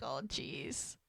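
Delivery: under-breath groan. Retold or imitated. Mel mortified, very soft.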